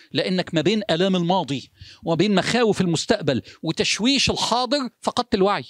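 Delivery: normal talking voice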